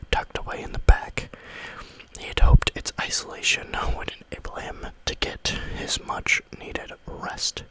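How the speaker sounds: usual speaking voice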